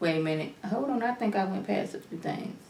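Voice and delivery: half under her breath, talking to herself; mumbling aside while losing her place; quiet muttering to herself. Real, not acted. Muttering to herself over her notes.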